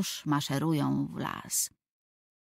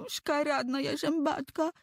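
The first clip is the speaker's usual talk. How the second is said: put-on crying boy; 9 semitones higher than usual. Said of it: "płacze Tomek".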